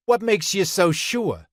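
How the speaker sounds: high-pitched, sceptical question; challenging boy. A narrator's reading. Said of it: "inquired Buzz".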